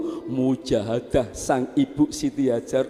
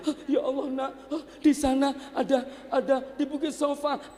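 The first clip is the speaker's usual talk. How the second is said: high, softer, hurried; quiet high gasping hope; breathless mother; 9 semitones higher, 5 dB quieter, faster than usual. Acted. Mother spots water, high and breathless.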